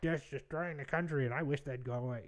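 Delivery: lightly mocking a grumbling political ranter; mock ranting. Vlogger parodying the angry critic he says he is not, mock rant. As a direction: run it together as a token 'rah, rah' rant. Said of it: "I'm not out there being like".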